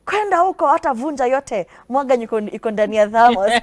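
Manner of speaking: high, loud mock yell at a child; imitating a shouting parent in Swahili; retold shout. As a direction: bark the Swahili order like an angry parent. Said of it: "you didn't shout and say".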